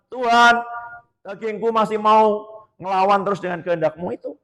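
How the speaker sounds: loud, rising preacher's fervour; fervent preaching. Acted. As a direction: drive it home from the pulpit. Preaching at full force. (khotbah berapi-api dan keras)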